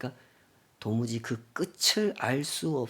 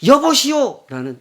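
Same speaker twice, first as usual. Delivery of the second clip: curt rude address; 11 semitones higher, 13 dB louder than usual. Shown as a demonstration. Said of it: "이두라는 말은".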